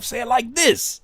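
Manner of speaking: "this" pitched high, then falling; right take demo. Shown as a demonstration.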